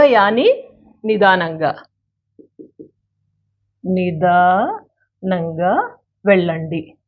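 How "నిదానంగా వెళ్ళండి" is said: drawn out syllable by syllable, with pauses; very slow, segmented model pronunciation; slow teaching demo. Shown as a demonstration. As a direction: stretch each part of నిదానంగా for learners. Teacher repeats it slowly for learners.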